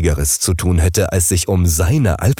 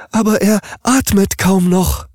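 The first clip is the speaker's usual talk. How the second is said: much higher, pleading in alarm; desperate mattress; 14 semitones higher, 3 dB louder than usual. Acted. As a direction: cry out that he is barely breathing. Mattress in despair.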